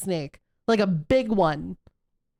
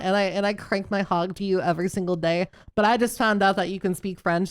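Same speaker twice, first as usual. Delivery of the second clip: ordinary talk, nothing marked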